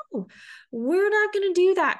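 high-pitched mock refusal from the brain; balking brain voice. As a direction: squeal it high, like a brain putting its foot down. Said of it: "our brain's like".

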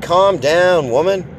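pompous macho put-down; hammed-up command to his girlfriend; mock bossy. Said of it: "I said".

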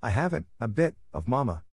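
plain reading voice

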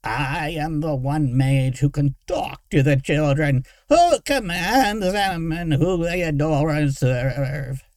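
whining, trilling, self-important villain; Derek. A narrator's reading. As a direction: declaim grandly in a high, wavering whine. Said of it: "the thing in front of her whined".

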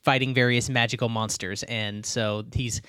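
usual speaking voice

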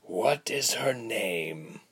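slow, weary stretched-out question; listless. A narrator's reading. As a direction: ask it as if bored, very slowly. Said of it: "the man asked again, listlessly".

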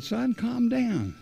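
lightly voiced pastor, drawn out and calming; imitating the pastor. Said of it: "This was your pastor doing this".

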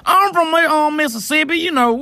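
hammed-up country accent. Mocking for co-host what country sounds like, high drawling country accent. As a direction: put on an exaggerated backwoods country voice. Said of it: "when I think of country, I'm like".

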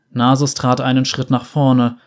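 ordinary delivery, nothing marked

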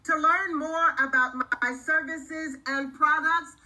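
slow, clear outro announcement; announcer read. Acted. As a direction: read it like a podcast sign-off.

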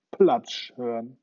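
ordinary delivery

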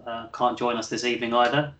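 ordinary talk, nothing marked

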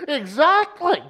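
high squeaky cartoon voice; cartoon character voice. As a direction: pitch it way up like a cartoon. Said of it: "S2 imitates S3's cartoon voice".

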